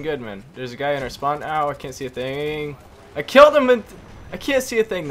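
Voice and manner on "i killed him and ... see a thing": much higher, loud yelp of triumph; excited shout. Real, not acted. Gamer blinded by a flash, yelling about his kill, high and loud. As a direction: blurt it out over the chaos.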